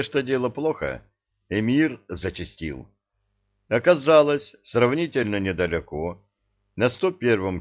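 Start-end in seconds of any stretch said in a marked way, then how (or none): none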